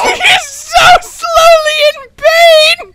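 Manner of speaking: high, drawn-out cry; stretched high-pitched lament; amused wail. Real, not acted. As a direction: wail it slowly in mock anguish. Crying out over suffering monster.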